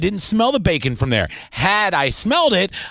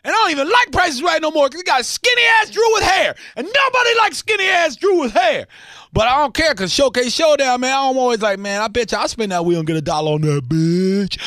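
excited neighbor yell; 10 semitones higher than usual. Acted. Host yells to his neighbor Ryan about the bacon smell. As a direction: call out to Ryan, thrilled by the smell.

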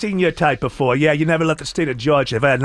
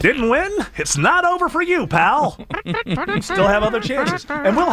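much higher, punchy sell; hyped promo read; 10 semitones higher than usual. Shown as a demonstration. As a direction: shout it like a hype radio ad. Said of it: "Add an exclamation point on".

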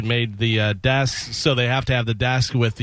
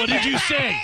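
put-on high, laughing drunk dad yell; drunk angry dad; 13 semitones higher than usual. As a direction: ham up a drunk angry father. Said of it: "saying that with one bottle in the hand".

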